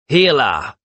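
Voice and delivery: sharp angry call from outside; furious shout. A narrator's reading. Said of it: "came a furious shout".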